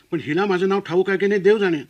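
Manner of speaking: ordinary delivery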